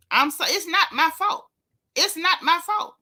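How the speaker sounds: high, whiny it's not my fault; mocking whine. Vlogger mocking the cast member's excuse, high whine. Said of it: "like that, that song [name]".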